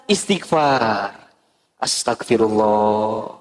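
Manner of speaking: low, slow breathy istighfar; hammed-up pious groan; mock pious sigh. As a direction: sigh it like a wounded wife. Acting wife's pious shock.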